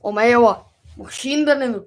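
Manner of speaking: loud, hammed-up fake Russian, rising on the last word. Shown as a demonstration. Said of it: "Say it in Russian".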